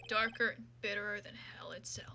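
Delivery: low, hushed, whispery aside; brooding murmur. Acted. Prosecutor musing over his coffee, low brooding murmur. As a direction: let it sink into a dark near-whisper.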